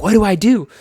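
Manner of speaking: mock panic that climbs; rising panic. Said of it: "we're just like".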